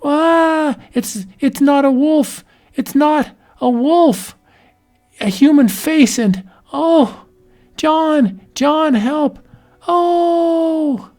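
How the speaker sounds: high, panicked pleading for John; she's seen a monster and screams for her husband; terrified cry for help. Acted. Woman sees werewolf, cries for John.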